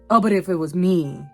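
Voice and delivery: ordinary delivery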